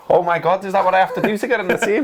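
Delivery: high, fast mock panic; mock dismay. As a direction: squeak it out in fake alarm. Acted quote of teammate's dismay.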